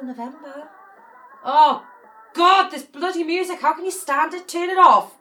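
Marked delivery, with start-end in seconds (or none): 1.4-5.1 louder, higher, fed-up complaint; irritated outburst. Acted. Jen, fed up after work, snapping about the Christmas music. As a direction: snap at the music to stop.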